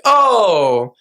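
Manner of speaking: high, falling "oh" of dawning understanding; hammed-up retold realization; sudden realization. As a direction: imitate someone finally getting it, high. Sergeant finally understands, retold.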